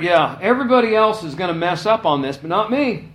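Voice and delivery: loud, high mock bragging; preacher mimics Peter's cocky boast. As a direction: brag that only you stand firm. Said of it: "Peter's like".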